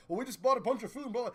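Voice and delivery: ordinary delivery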